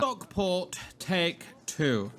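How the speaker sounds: slow, drawn-out posh English announcement; mock-posh English, each word spaced out. Real, not acted. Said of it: "I don't know why I said it all posh".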